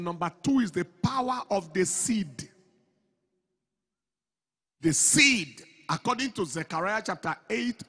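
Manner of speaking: shouting the blessing to the congregation; preaching at full force. Acted. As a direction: drive it home like a revival preacher.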